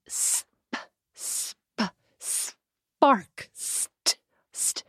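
coaxing "Sp-" and "st-" as soft breathy stammered starts; speech coaching sounds. Shown as a demonstration. Mother coaxing her toddler in speech therapy, soft sound prompts. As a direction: whisper the first sounds of "spark" to coax a toddler. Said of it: "I cajole him".

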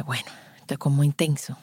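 barely voiced, as if confessing it's too much; hushed whisper. Overwhelmed new mother whispers to the consultant, hushed.

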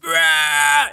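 squeaky cartoon-fish wow. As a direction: mimic a voice actor's big exclamation. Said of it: "des fois il fait".

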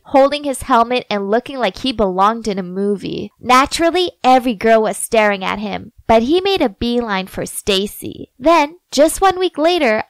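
plain reading voice

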